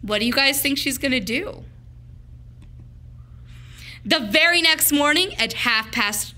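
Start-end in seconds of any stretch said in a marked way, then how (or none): none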